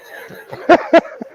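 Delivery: high, breathy burst of laughter; laughing. Real, not acted. Cracking up after reading out a crude coffee slogan, laughing. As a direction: crack up at a silly line.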